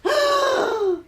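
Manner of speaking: long, high voiced intake of breath; reviving gasp. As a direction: gasp back to life. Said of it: "She takes a breath".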